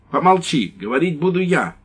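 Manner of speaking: high, loud domineering order; bossy wife. Acted. Wife silencing her husband, high and bossy. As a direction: shut him up, commanding.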